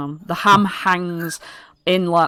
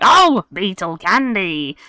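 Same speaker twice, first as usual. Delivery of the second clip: goblin hawker; 5 dB louder, slower than usual. Acted. Goblin vendor responds.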